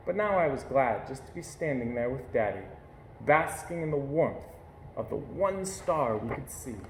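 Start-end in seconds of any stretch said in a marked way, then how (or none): none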